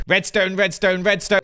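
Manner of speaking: Tango. Pretend. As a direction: parrot a friend's pet word. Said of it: "Hello, Tango".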